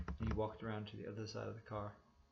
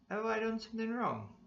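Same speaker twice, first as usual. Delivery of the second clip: oily passenger; 12 semitones higher, 5 dB louder than usual. A narrator's reading. Hitchhiker answering the cop, high and oily. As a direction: make it smooth and innocent.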